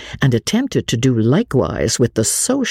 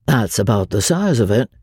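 laconic showman; 3 semitones lower than usual. Acted. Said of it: "agreed Doc".

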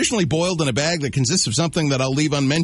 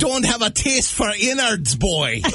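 much higher, hammed-up Scottish accent; 8 semitones higher than usual. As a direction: tease them like a gruff Scotsman in a broad Scottish accent. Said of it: "You should do that all day".

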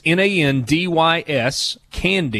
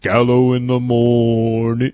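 radio promo voice; 3 semitones lower, slower than usual. Retold or imitated. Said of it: "the one where he goes".

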